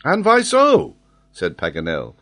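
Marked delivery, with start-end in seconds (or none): 0.1-0.9 put-on high, loud French-accented challenge; stung. A narrator's reading. Geographer touched in his weak point, stung. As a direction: snap back with wounded pride. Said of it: "touched in his weak point".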